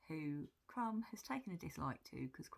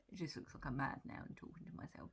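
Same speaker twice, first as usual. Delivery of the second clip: ordinary delivery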